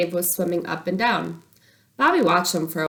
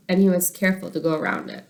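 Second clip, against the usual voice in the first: ordinary delivery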